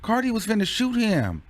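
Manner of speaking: ordinary talk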